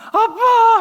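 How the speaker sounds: high, loud cry of joy; boy crying out to his father in joy; joyful cry. Retold or imitated. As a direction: shout it as the boy runs to his dad. Child runs to his father.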